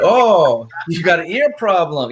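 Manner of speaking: put-on a boy hamming up a gangster; kid wise guy. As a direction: kid playing mobster to his dad. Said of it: "He's like".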